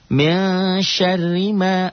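high, chanted Arabic phrase; recitation demo. Shown as a demonstration. Teacher showing how to read it, high chant. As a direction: sing out the words like tajwid practice. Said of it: "ma-nya matobi'i".

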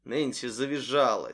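ordinary delivery

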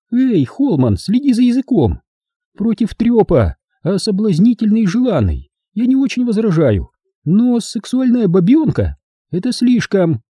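high-pitched, scolding female voice; indignant woman character. Acted. Hilda objects to the guest's crude remark, high indignant woman's voice.

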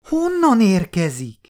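put-on a small girl puzzled and eager; curious little girl. Child asks curiously.

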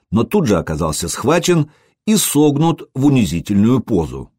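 plain reading voice

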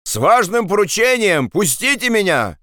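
high-pitched urgent call; pleading shout. Acted. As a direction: call out to be let through. Andres keeps shouting to be let in. (настойчивый крик с мольбой)

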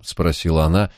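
ordinary delivery, nothing marked

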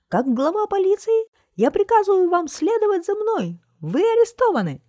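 very high, loud squeaking command; squeaky shout. A narrator's reading. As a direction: squeak orders like a tiny police chief. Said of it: "крикнул". (громкий высокий писклявый приказ)

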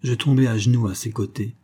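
usual speaking voice

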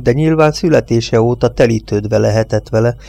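usual speaking voice

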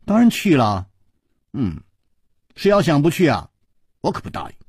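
plain reading voice

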